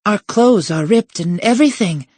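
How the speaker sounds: high-pitched mock wailing. Girl wailing to the unconvinced professor. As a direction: wail a girlish protest, high. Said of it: "Septima wailed".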